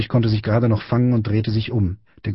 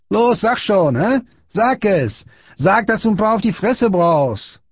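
high, insistent aggressive shout; bullying command; 11 semitones higher, slower than usual. A narrator's reading. Big boy ordering Friedrich to say it, insistent shout. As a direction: badger him to say it.

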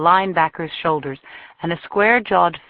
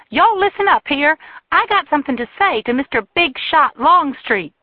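much higher and louder, calling the room; calling out to the bar; 10 semitones higher, 5 dB louder than usual. A narrator's reading. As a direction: announce it to everyone, furious. She calls the whole bar.

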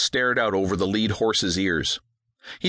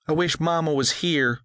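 put-on higher, sad girl's voice; young girl, a touch sad; longing girl; 7 semitones higher than usual. A narrator's reading. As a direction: daughter missing her mother.

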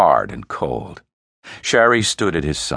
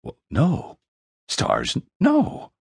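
stunned disbelief; 9 semitones higher, slower than usual. Acted. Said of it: "His lips shook".

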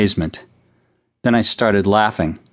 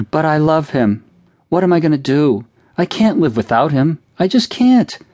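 put-on sobbing child; 7 semitones higher than usual. A narrator's reading. Cindy sobbing to her mother that she can't go on. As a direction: wail in childish heartbreak. Child crying over Washington.

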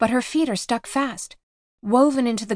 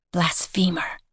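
unearthly whisper. Acted. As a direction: ghostly whisper from everywhere. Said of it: "whispers a voice that seems to come from everywhere".

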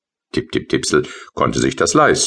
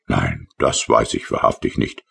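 usual speaking voice

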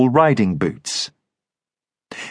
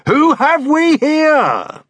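loud, high, swaggering soldier calling out; dragoon leader; 3 dB louder, much slower than usual. Acted. Dragoon hailing a stranger outside the inn, loud and swaggering. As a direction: hail the stranger like a rowdy officer.

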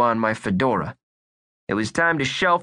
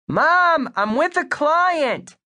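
calling down; slower than usual. Acted. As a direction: shout down to mom, embarrassed. Boy shouts down to mom.

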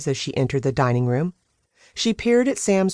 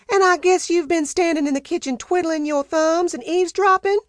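Peaches putting her foot down; 14 semitones higher than usual. Acted. Housekeeper cutting off Sam's protest, high and stern. Peaches voiced in the dinner scene.

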